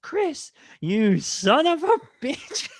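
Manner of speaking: high, slow, laughing hammed-up call; mock movie greeting. Greeting friends in the high school hallway with a hammed-up, laughing movie call. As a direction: drag it out, cracking up at the end. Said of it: "we'd see each other, like, with our boys".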